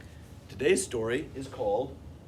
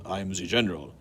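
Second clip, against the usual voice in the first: lower, gruff engine voice; the General voice; 5 semitones lower, 5 dB louder than usual. Acted. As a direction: announce yourself as the General. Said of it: "who everyone called the General".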